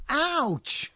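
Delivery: high, yelped ouch; sudden ouch. Real, not acted. Host hit by something mid-sentence, high yelp. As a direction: yelp as if stung.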